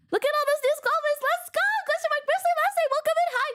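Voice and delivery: excited streamer welcoming new members in a high voice; excited welcome. Real, not acted. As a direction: lift way up and cheer them in.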